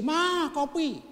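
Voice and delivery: a high, loud bossy call for the wife; husband calling wife from the sofa, high and loud; demanding husband call. Retold or imitated. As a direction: bark an order at the wife for coffee.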